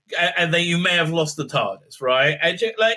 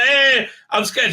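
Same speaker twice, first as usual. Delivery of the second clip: mock scared; 8 semitones higher, 4 dB louder, slower than usual.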